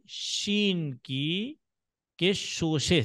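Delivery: very slow, each word spaced; slow emphasis. Shown as a demonstration. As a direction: stretch it out for the class.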